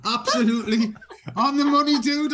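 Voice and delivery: loud, high, emphatic praise; loud agreement. Real, not acted. Cheering Ethel on over the radio, loud and emphatic. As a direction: hit it hard, backing her fully.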